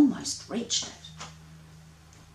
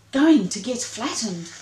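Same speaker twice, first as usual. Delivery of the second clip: cried; 4 semitones higher, 6 dB louder than usual. Acted. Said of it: "cried Ring-tailed Monster".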